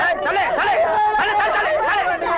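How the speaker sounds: high, loud urgent shouts; alarmed shouting. Acted. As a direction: yell it out to warn everyone.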